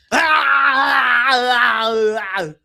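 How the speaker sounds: long, loud, drawn-out yell; Arnold-style roar. Retold or imitated. Preacher imitating Arnold flipping tables, long loud berserk roar. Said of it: "like Arnold, that's how he do".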